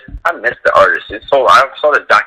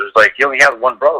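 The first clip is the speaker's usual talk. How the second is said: normal talking voice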